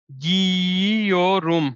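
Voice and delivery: drawn-out, syllable by syllable गियोरुम; slow demo word. Shown as a demonstration. Teacher spelling out the Turkish verb for students, very slow. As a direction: stretch out गियोरुम so students catch each syllable. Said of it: "युमशक गे में बदल देना है".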